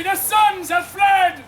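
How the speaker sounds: slow, loud, high announcement; proclaiming shout. Acted. Nobles spreading news of the fleeing sons, proclaiming shout. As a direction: shout it word by word to a crowd.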